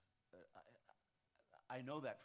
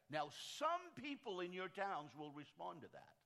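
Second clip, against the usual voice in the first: raised, louder preacher's cadence; preaching at full force; 6 semitones higher, 6 dB louder than usual. Acted. Pastor rallying church leaders, preaching at full force. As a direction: drive it home like a pulpit sermon.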